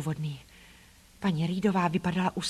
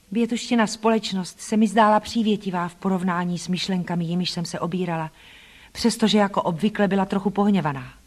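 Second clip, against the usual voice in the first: ordinary delivery, nothing marked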